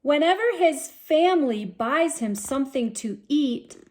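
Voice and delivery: usual speaking voice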